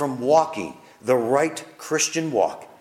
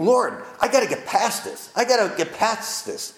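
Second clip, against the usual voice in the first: pleading prayer; 8 semitones higher than usual. Retold or imitated. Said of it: "And I'm thinking".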